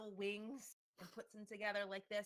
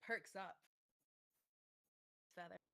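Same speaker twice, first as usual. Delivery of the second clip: usual speaking voice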